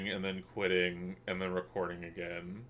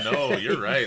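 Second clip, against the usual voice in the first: normal talking voice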